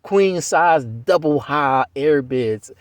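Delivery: each word drawn out slowly; slow emphatic. Real, not acted. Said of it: "That's all I still get to this day".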